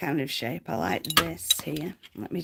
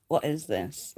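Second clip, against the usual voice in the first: ordinary talk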